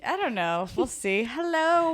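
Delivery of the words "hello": bright high showy hello; mock grand hello. Host opening the podcast with a showy greeting. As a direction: sing out the hello to open the show.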